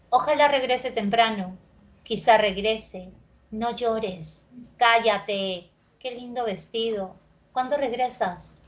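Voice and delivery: each example sentence ends on a falling tune. Shown as a demonstration. Teacher reading example sentences to the class, each ending on a fall. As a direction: let every phrase drop at the end. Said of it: "tienen tono descendente".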